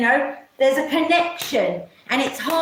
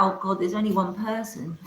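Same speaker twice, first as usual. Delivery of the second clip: ordinary talk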